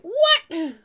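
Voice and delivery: imitating a loud, high outraged reaction; mock a stunned friend's outburst; shocked outcry. Friend imagining how another will react on hearing it, shocked outcry. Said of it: "he's gonna be like".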